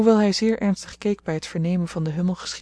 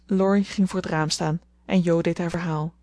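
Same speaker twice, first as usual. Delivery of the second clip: usual speaking voice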